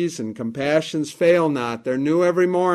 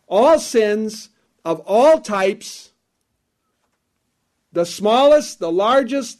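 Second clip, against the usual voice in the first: building emphasis; 4 semitones higher than usual. Real, not acted. Preacher builds emphatic list.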